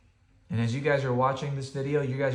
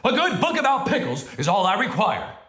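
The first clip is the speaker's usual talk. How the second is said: getting louder; 9 semitones higher, 6 dB louder than usual. Acted. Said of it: "Bear's voice was getting louder".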